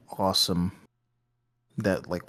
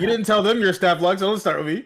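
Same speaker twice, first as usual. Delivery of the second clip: loud mock-indignant retort; playful indignant shout; 9 semitones higher, 6 dB louder than usual. Real, not acted. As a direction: fire it back loud and teasing. Playful bickering with a friend.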